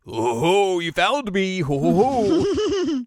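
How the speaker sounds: loud, high theatrical villain cackling; hammed-up villain. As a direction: crow it like a cartoon villain caught lurking. Villain revealed, theatrical greeting.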